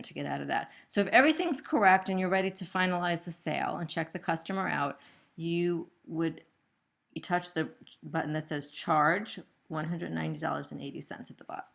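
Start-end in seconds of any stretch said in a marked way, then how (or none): none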